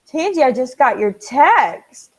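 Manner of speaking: loud, drawn-out thrilled reaction; excited reaction. Real, not acted. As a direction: let big news burst out slowly and loudly. Has just seen exciting news.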